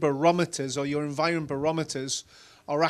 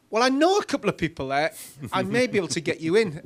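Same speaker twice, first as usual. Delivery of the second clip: sly understatement; 4 semitones higher, 6 dB louder than usual. Retold or imitated. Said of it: "I was like".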